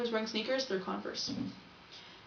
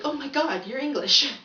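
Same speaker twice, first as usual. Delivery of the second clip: high, loud, gushing discovery; re-enacted squeal of surprise, building louder; amazed exclamation; 5 semitones higher, 8 dB louder than usual. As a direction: burst out in delighted astonishment. Retelling her own amazed reaction.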